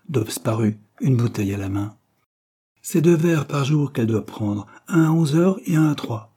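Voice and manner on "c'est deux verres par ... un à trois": higher, emphatic announcement; blunt cry. A narrator's reading. Servant announcing the doctor's orders, blunt cry. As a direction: give the order with no preamble. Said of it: "cria-t-elle sans circonlocution".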